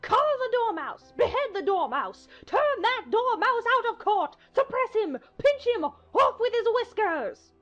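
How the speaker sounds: high, loud shrieked commands; enraged Queen. Acted. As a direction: shriek each order at the court. Queen rages at the Dormouse.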